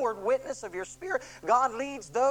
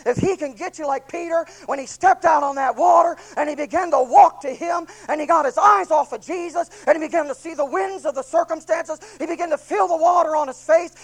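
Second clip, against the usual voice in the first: loud, higher, building preacher's cry; preaching at full force; 5 semitones higher, 12 dB louder than usual. Acted. Preacher telling the congregation about Peter sinking, full-force loud preaching. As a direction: drive it home at full volume.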